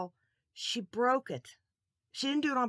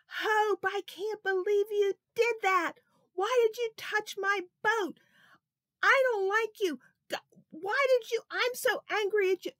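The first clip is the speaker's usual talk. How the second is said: much higher, louder furious holler; angry yelling; 13 semitones higher, 9 dB louder than usual. Acted. Big brother yelling at Hope over his broken boat, furious. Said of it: "He started to yell and holler".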